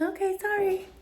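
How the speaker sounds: squeaky high sorry; high, meek apology; high apologetic voice. Retold or imitated.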